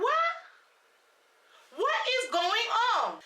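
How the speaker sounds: high-pitched "what?", then drawn-out disbelief; squeaked shock stretching into a slow demand; incredulous outburst. Retold or imitated. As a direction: react in exasperated disbelief. Acted disbelief at Kenneth's phone use.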